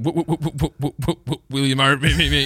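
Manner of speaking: stuttering; stammering on every word. Retold or imitated. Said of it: "You stuttered?".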